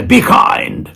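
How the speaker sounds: high, playful superhero proclamation; hammed-up superpower command; mock magic command. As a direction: pronounce it like casting a spell. Casts his kindness superpower on people.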